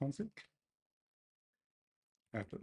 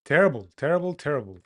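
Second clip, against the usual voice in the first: loud, high terrible, terrible; despairing yell; 5 semitones higher, 15 dB louder, much faster than usual. Real, not acted. Coder groans at terrible code, loud and high. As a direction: wail it at the screen.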